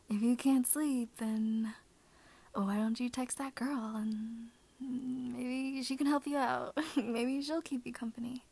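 ASMR whisper. Acted. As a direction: hush it close to the mic. Holds soft ASMR whisper.

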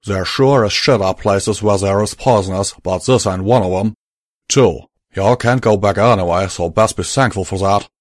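loud, firm Southern cowboy. Acted. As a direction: lay it out like a frontier gunslinger. Calamity, southern drawl, firm.

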